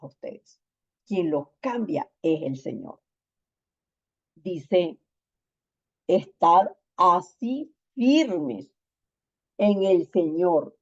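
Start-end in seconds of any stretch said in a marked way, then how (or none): 6.1-10.8 scripture reading. Acted. Reading Paul's exhortation.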